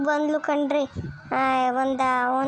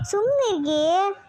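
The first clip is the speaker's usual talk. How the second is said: higher, slow, stretched-out hushing; drawn-out consoling hush; 4 semitones higher than usual. Acted. Friend urging the upset woman to hush, drawn-out and consoling. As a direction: stretch it out in a raised pitch.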